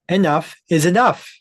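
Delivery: emphatic outburst. Real, not acted. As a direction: slam it down like a final word. Ranting about power.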